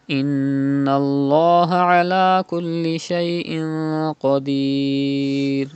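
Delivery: melodic chanted tajweed recitation; Quran recitation. Shown as a demonstration. Teacher recites the next verse, chanted.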